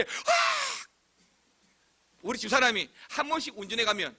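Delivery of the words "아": very high squeal of delight; excited scream. Shown as a demonstration. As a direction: imitate a scream of pure joy. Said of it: "정말 좋을 때". (좋아서 지르는 높은 비명 흉내)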